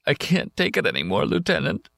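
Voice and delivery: high, breaking tearful plea. Acted. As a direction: say it close to crying. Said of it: "a man sitting against that big tree crying".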